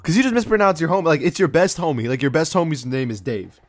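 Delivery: normal talking voice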